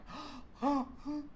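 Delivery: high startled gasp; sharp gasp. Real, not acted. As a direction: gasp as if seeing the guillotine. Reacts to the story.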